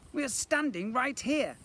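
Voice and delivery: high, loud protest; indignant addition. A narrator's reading. Twin to the shouting boy, loud protest. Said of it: "added Edmund".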